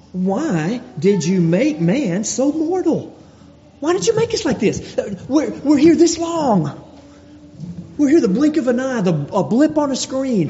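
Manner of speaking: high, pleading lament asking why man is mortal; pleading question to God. Acted. Preacher voicing the psalmist asking God, high pleading lament. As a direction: cry out to God from the heights. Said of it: "a question is asked".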